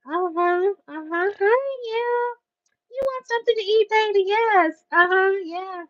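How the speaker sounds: high, soft and sugary, luring you in; sugary Southern hostess. Retold or imitated. Southern host sweetly inviting a visitor to eat. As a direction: coax like a sweet auntie offering food.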